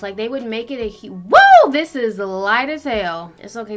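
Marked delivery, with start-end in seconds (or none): 1.1-1.7 sudden high loud "woo!"; a shocked squeal at the mirror; startled yelp. Real, not acted. Seeing her foundation is too light, shocked high yelp.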